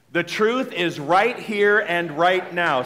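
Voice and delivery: slow, deliberate affirmation; a slow group affirmation. Shown as a demonstration. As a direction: say each word slowly. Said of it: "Let's do it together".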